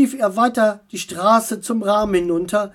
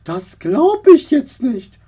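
disappointed; 5 semitones higher, 7 dB louder than usual. Acted. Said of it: "sagte sie enttäuscht".

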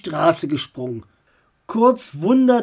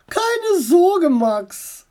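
aunt voice; 10 semitones higher, much slower than usual. Acted. Aunt appears behind him.

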